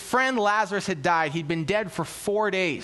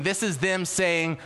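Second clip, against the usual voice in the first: ordinary talk, nothing marked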